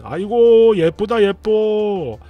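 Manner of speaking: slow, cooing over the little ones; doting over kids. Retold or imitated. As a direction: coo it like a doting grown-up. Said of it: "이런 소리를 하고".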